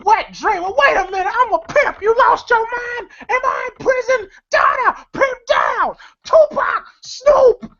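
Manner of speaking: very high-pitched, hammed-up tirade; mock outraged shouting. Soaked pimp protesting and calling for help, in mock outraged shouts. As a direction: build to frantic high shouts for backup.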